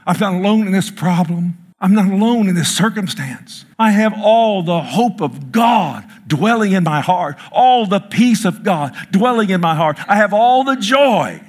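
climbing pulpit proclamation of joy; preaching at full force. Acted. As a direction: build the declaration to a joyful peak. Preacher's rising declaration.

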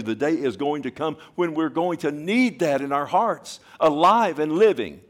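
loud, high fervent preaching; preaching at full force. Acted. Preacher assuring the church God never fails, loud and fervent. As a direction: thunder the promise to the congregation.

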